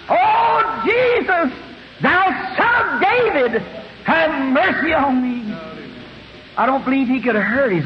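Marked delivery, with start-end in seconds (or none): 0.1-5.5 high-pitched cry for mercy; screaming plea. Acted. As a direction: call out high over a noisy crowd. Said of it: "There's too much screaming and going on".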